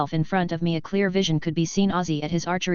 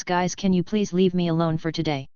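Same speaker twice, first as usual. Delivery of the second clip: usual speaking voice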